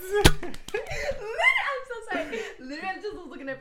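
giggling apology; breathless with laughter; laughing. Real, not acted.

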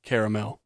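CARAMEL said in full as care-uh-mel; three-syllable caramel. Shown as a demonstration. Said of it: "how you pronounce the word".

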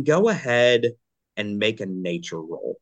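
usual speaking voice

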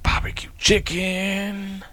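slow breathy whisper. Real, not acted. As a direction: whisper the order slowly. Whispered food order.

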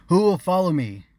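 loud rallying call. A narrator's reading. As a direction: call out to the crowd. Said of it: "he called".

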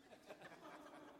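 soft breathy laugh; fond laugh. Real, not acted. Father laughing at his son's odd word. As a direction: laugh quietly at the memory. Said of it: "Where does a six-year-old get".

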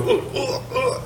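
soft, voiceless retching noises; imitated dry heaves. Man about to throw up in bed, mock dry heaves. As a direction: mimic gagging under your breath. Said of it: "I kept going".

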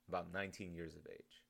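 usual speaking voice

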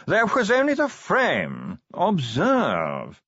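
a fussy, high-class curator drawling slowly, words half yawned; a preoccupied chicken of a posh gentleman, dragging each word; posh yawning curator. Acted. Curator voiced in story.

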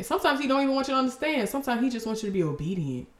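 ordinary delivery

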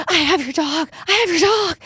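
high, urgent caller impression; frantic older lady. Impersonating the lady who found her dog, frantic and high. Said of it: "I have to impersonate her though".

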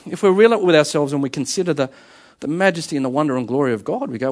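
ordinary delivery

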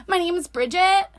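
put-on whiny voice; whiny customer. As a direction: imitate a nasal whiner giving her name. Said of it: "she was a whiner".

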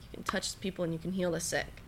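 soft, breathy, nearly whispered; imitating the pastor's hushed words; hushed retelling. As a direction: whisper it like a secret blessing. Pastor's quiet words, retold.

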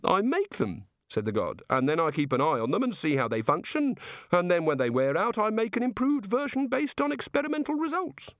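high, eager voice of the god; high-pitched, fussy god voice. Acted. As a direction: play the chatty, enthusiastic creator god. The god speaks.

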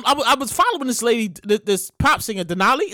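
normal talking voice

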